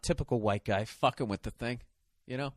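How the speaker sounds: usual speaking voice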